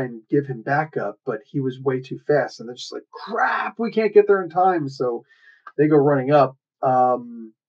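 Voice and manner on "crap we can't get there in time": high-pitched acted alarm; mock dismay. Heroes too slow to catch up, mock dismayed cry. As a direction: act out exasperated panic, lightly.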